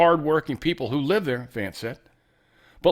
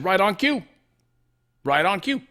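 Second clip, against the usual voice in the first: raised pitch, imitating an out-of-context sound bite; quoted gotcha; 6 semitones higher than usual. Host showing how a quote could be chopped, mock gotcha. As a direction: pounce on the phrase in a higher voice. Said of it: "He said".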